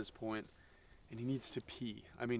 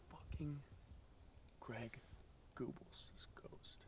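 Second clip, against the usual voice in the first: spooky whisper; 2 semitones higher, 16 dB quieter, slower than usual. A narrator's reading. Spooky ghost reveal.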